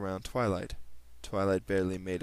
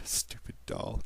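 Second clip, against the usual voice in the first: muttered whisper; 4 dB quieter than usual. Acted. Twilight muttering at the doll.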